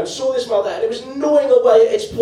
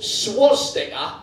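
drawn-out Geordie pronunciation of swastika; Geordie accent; much slower than usual. Shown as a demonstration. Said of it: "in a Geordie accent".

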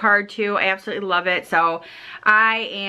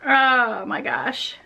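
slow, teary breath of emotion; real tears welling up; overwhelmed by a kind gift, near tears; choked up; much slower than usual. Real, not acted. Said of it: "It's, like, making me cry".

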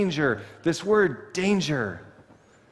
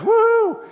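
a quick high joyful woo; excited whoop; 8 dB louder than usual. Real, not acted. Preacher celebrating being here, sudden high whoop. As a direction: let out a playful cheer. Parents made that choice.

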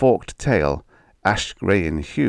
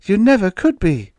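put-on very high, loud little girl; defiant child's outburst; 7 dB louder than usual. A narrator's reading. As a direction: stand up to him, shrill and loud. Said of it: "spoke up defiantly then".

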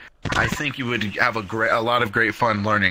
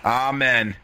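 ordinary talk, nothing marked

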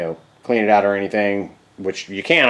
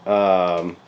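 ordinary delivery

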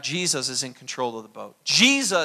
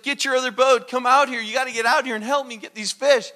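louder retold call from a boat full of fish; yelling to partners; 7 semitones higher, 6 dB louder than usual. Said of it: "he yells out to his partners".

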